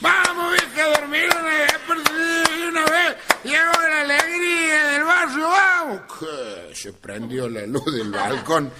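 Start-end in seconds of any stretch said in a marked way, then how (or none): 0.0-6.0 hammed-up drunk calling out; drunken yell. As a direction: bellow it up at the balcony. Drunk man yelling at the house.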